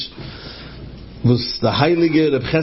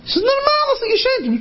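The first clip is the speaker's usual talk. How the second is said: high mock exclamation. Quoted exclaiming character.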